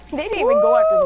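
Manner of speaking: loud, high excited yell; excited shout. Real, not acted. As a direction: shout it in delight. Excited game moment.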